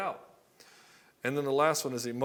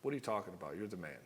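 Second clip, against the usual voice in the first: a lower, much softer weary reply; tired mom; 6 semitones lower, 9 dB quieter, faster than usual. Retold or imitated. An exhausted mom answering her whining husband, lower and much softer. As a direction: sigh it out, too tired to argue. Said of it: "And she's like".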